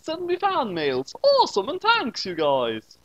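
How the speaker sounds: ordinary delivery, nothing marked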